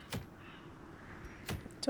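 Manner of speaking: slow, high whisper. Pretend. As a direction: ask it softly, slowly. Whispered question to characters.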